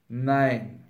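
low, unmoved no; placid, settled refusal; calm refusal. A narrator's reading. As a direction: refuse without any heat. Wolf calmly refuses again.

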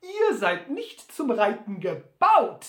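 höher, lauter, langsam; ungläubig. Acted. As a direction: gedehnt zweifelnd als Sam. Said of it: "fragte dann ungläubig". (Sam mustert Butler ungläubig)

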